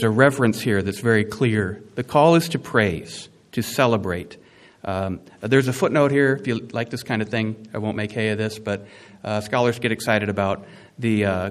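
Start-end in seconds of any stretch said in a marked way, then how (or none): none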